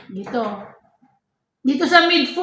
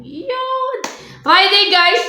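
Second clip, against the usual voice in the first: excited; 7 semitones higher, slower than usual. Real, not acted. Excited shout about shoe giveaway.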